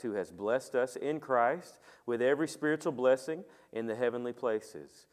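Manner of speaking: ordinary delivery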